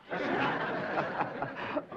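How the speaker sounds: breathy unvoiced chuckle; airy laugh. Teacher amused by the rustic girl, breathy chuckle.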